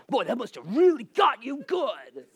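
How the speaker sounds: slow, swooping mimicry; teasing listener. Congregant teasing pastor about the photo, slow put-on voice. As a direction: draw it out in a put-on needling voice.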